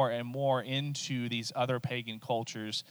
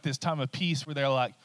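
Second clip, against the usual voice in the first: ordinary talk, nothing marked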